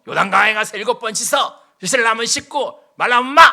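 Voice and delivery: curt dismissal. Retold or imitated. Prophet snapping at the general, brusque shout. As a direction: brush him off rudely. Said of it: "이러잖아요".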